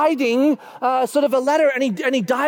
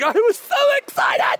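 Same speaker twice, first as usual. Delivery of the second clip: high-pitched excited yell; mock excited shout; 14 semitones higher than usual. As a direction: shout it back in comic excitement. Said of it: "you were shouting a lot today".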